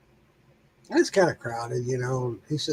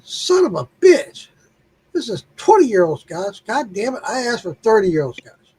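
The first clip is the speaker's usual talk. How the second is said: angry outburst; 4 semitones higher, 3 dB louder than usual. Acted. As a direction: blow up at the bartender. Joke customer complains to bartender.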